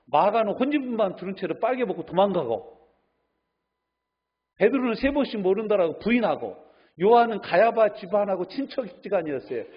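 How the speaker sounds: high, loud sermon delivery; impassioned preaching. Real, not acted. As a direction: press each accusation home to the congregation, pitched high and loud. (격정적으로 외치는 설교)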